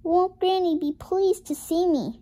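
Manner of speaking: put-on high, cheerful girl's voice; little girl voice. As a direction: think it happily like a young girl. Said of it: "she thought".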